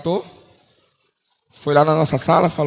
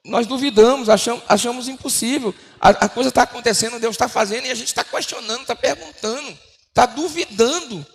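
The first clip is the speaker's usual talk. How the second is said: higher, building preacher's cadence; preaching; 6 semitones higher than usual. Acted. Preacher exhorting brothers about doubting God, rising. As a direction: lift the congregation, slowing at the end.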